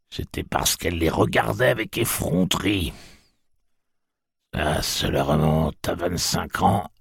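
put-on an old woman telling a bitter memory; old aunt. A narrator's reading. Aunt Talitha tells the travellers how Mercy was blinded.

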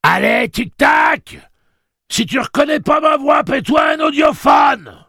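very high, full-throated angry shout; piercing, drawn-out holler; yelling. A narrator's reading. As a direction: scream it into the intercom, exasperated. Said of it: "et hurla".

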